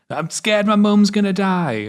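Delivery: high, slow, hammed-up confession; cliche kids film line. Boy finally confessing to the tree, hammed-up cliche. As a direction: overplay it like a child in a weepy film. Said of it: "cliche kids film dialogue".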